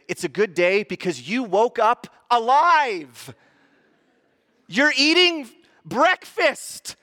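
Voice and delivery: loud, high, slow proclamation; exaggerated emphatic exclaiming to the kids; retold near-yell. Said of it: "I didn't yell 'cause my".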